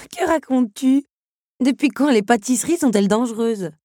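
high, loud scornful questions; touch of contempt. A narrator's reading. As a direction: scoff at a ridiculous claim. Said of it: "avec une pointe de mépris".

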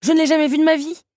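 fast, high, loud protest; indignant denial. A narrator's reading. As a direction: deny it in a high rush. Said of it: "affirma Belle". (démenti précipité, aigu et fort)

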